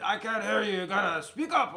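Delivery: imitating a gruff Italian shopkeeper; gruff Italian accent. Ice cream man can't hear his customer, gruff Italian accent. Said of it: "And his gruff voice".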